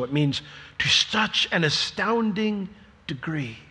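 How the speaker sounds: normal talking voice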